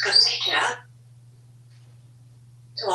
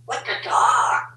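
ordinary talk

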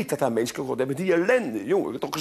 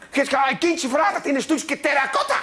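ordinary talk

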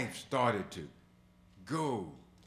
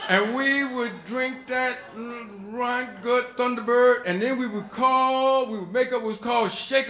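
excited; 12 semitones higher, 11 dB louder than usual. Real, not acted. Excited recalling drinking days.

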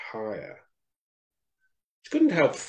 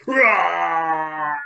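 long, high roaring cry; lion's roar; 4 semitones higher, 5 dB louder than usual. A narrator's reading. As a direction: let out a drawn-out lion roar. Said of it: "heard Lion's roars".

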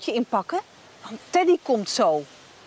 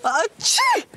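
loud sneeze; 11 semitones higher, 3 dB louder than usual. Acted. Fake sneeze again.

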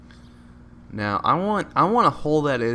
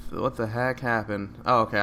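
normal talking voice

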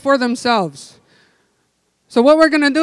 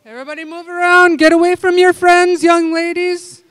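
calling out; 3 semitones higher, 6 dB louder than usual. Real, not acted. Calling out to the room.

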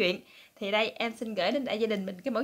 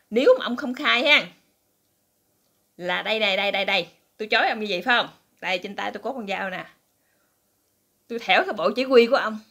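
louder, ranting threat to a cheating husband; wife interrogating her cheating husband, loud and menacing; furious threat; 6 dB louder than usual. Retold or imitated. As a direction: bark it out like a jealous wife with a knife. Knife-wielding wife threatens husband.

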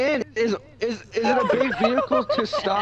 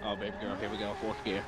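quiet; 13 semitones lower, 13 dB quieter, faster than usual. Real, not acted. Talking low to car.

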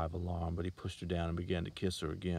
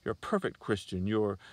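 higher, louder, bitter accusing; bitter accusation; 4 semitones higher, 6 dB louder than usual. A narrator's reading. Girl accusing the salesman of hypocrisy, bitter and loud. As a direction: lash out at his hypocrisy. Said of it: "she hissed".

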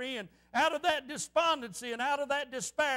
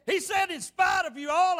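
raised, louder preacher's voice; retold defiant declaration; 5 semitones higher, 6 dB louder than usual. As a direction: declare it over their objections. Retold defiant praise to other preachers.

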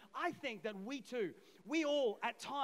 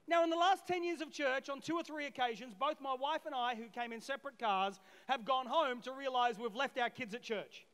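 ordinary delivery

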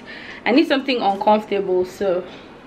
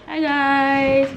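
bright, higher and louder hello to the camera; upbeat greeting; 7 semitones higher, 5 dB louder than usual. Real, not acted. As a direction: greet the viewers with a burst of energy. Cheerful vlog greeting to viewers.

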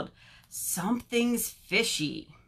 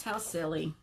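ordinary delivery, nothing marked

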